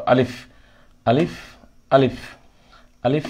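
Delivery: আলিফ said short and quick; quick clipped আলিফ drill; quick alif. Shown as a demonstration. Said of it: "দ্রুত উচ্চারণ করব".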